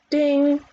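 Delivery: high, ringing "ding"; imitated bell ding. A narrator's reading. Narrator voicing the round bell, high ding. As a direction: sound the bell with the voice.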